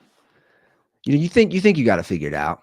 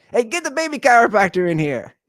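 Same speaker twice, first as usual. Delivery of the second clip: mock command; 13 semitones higher, 5 dB louder than usual. Imagined Vance calls his chiropractor.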